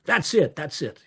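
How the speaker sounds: high-pitched cheer, falling away; imitated shouts of encouragement; crowd crying out. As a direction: urge the speaker on. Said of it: "they would cry out".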